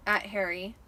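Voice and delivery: plain reading voice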